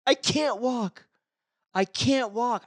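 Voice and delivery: high-pitched tearful wail, hammed up; exaggerated weepy whine; mock crying. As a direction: imitate someone bawling in protest. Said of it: "She starts crying".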